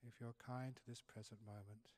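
soft, unhurried meditation voice with long pauses; hushed, calm meditation teacher easing them into the present; guided meditation. Real, not acted.

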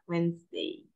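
"Wednesday" said WENZ-day, softly; silent middle. Shown as a demonstration. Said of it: "bagian tengahnya dia tuh nggak dibaca". ("Wednesday" dibaca pelan WENS-dei, tanpa D)

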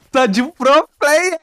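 high, slow, drawn-out teasing voice; mock pro player voice. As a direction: stretch it out like teasing a show-off. Mocking teammate's skill.

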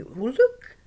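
high, loud delighted call; excited. Retold or imitated. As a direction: call out thrilled. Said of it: "he was all excited".

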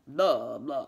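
quick mock Transylvanian vampire "blah"; Transylvanian accent. Shown as a demonstration. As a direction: toss out a Dracula-style Transylvanian blah. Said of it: "that accent".

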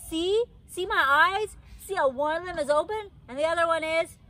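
high, loud and eager; eager showing off. A narrator's reading. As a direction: push your face at him, see my eyes. Child showing her eyes eagerly.

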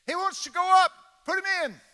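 high, loud holler across the airfield; hollering to the pilot. Retold or imitated. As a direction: call over to the pilot. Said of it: "he hollered at the pilot".

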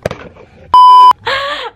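high, loud laughing fit. Real, not acted. Big laugh after prank.

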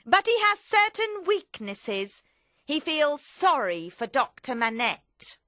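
high, loud voice of complaint; forceful, high-pitched character; Madame Defarge. Acted. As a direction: raise the voice and state it hard. Narrator acts Madame Defarge's speech.

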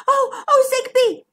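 high, breathless little zebra cousin; gasped alarm. A narrator's reading. Zara runs in to her cousin Zigbee, gasping. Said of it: "she gasped".

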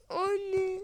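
high sobbing gasp; crying gasp. Acted. Daughter crying as she calls her mother. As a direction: cry out to mom. Daughter coming home upset.

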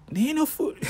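squeaky high outraged disbelief; hammed-up hungry Jesus, shocked at the empty tree; mock disbelief. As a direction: pitch way up on no food. Jesus voiced, pitch jumps high.

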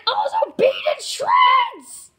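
loud shout, drawn-out last word; playful shout. Pretend. Adding names to the good guys, playful shout. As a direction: belt out the names.

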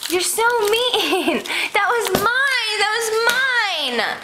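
high, slow whine; whining. Pretend. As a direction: sulk like a cheated kid. Whining over lost candy.

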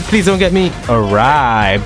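normal talking voice

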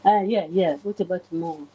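normal talking voice

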